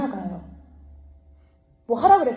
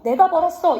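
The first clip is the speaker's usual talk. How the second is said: smug, higher and louder boast; proud boast; 6 semitones higher, 5 dB louder than usual. Retold or imitated. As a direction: brag like the money was all yours. Proud boast voiced in sermon. (잘난 척하는 자랑)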